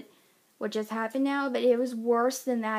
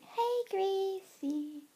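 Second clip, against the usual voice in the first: high, drawn-out singsong greeting; cooing to a pet; 9 semitones higher, much slower than usual. Real, not acted. Owner greeting her cute pet, high drawn-out cooing. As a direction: coo it slowly, high and singsong.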